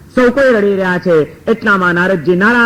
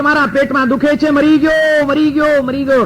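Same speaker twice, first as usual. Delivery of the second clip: higher pitched pretend wailing; mock cries of pain; 6 semitones higher than usual. Guru coaching the disciple how to fake dying, high pretend wail. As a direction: fake a painful cry, pitched up. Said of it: "એકાએક રાડો પાડવા માંડજે, જોર જોરથી".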